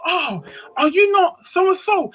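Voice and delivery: high, drawn-out acted greeting; surprised recognition. She goes.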